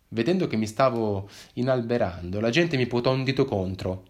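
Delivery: ordinary delivery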